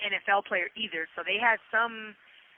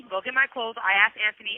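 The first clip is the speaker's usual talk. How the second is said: ordinary talk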